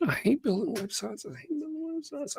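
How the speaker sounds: mock whine. Exaggerated complaint about websites.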